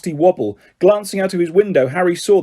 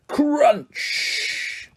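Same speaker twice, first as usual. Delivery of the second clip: crash sound; 10 semitones higher, 5 dB quieter than usual. A narrator's reading.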